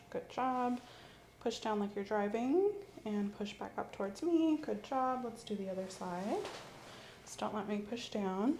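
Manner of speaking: ordinary talk, nothing marked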